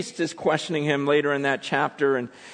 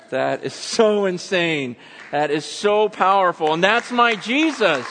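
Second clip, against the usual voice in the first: emphatic, raised-pitch proclamation; impassioned preaching; 10 semitones higher, 4 dB louder than usual. Real, not acted. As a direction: testify with full conviction. Preaches at full force.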